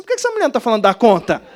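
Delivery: loud, fast mock outrage; comic exasperation. Pastor retelling his irritation at the unfinished phrase, exasperated. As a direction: burst out in hammed-up annoyance. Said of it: "Aí eu comecei a ficar com raiva".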